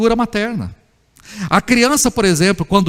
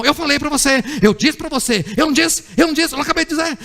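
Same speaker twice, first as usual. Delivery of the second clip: higher-pitched imitation of dad's sermon; lecturing father; 6 semitones higher, faster than usual. Father lecturing his hurt child, higher-pitched insistent scolding. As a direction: nag insistently like a father proved right. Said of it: "o pai dá sermão".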